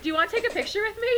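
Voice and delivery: fast, high, loud eager offer; bubbly invitation. Retold or imitated. Voice actress inviting a fan for a photo, fast high bubbly. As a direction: rush the offer out brightly. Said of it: "then she's like".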